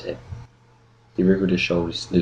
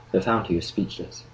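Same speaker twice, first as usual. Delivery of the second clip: ordinary delivery, nothing marked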